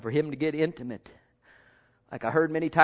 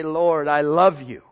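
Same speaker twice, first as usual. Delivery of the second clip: prayer; 2 semitones higher, 9 dB louder, slower than usual. Acted. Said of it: "bend a knee and say".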